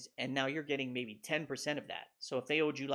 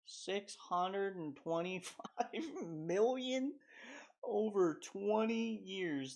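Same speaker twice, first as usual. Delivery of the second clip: slow, higher, laughing mockery of the number; mocking repeat; 4 semitones higher, 4 dB quieter, much slower than usual. Real, not acted. Scoffing at the sponsorship price, slow and mocking. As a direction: drag out the figure like it's absurd.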